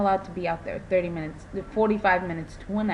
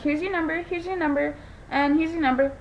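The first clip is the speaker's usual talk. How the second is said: bright, high sing-song customer-service voice; chirpy number handout; 7 semitones higher than usual. Retold or imitated. Handing out numbers to crowding guests.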